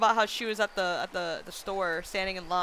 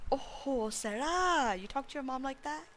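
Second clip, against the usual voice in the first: quieter replay of a scandalized mom; softened mock scolding; 2 semitones higher, 8 dB quieter than usual. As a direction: imitate mom's scolding under reduced volume. Replays mom's scolding to self.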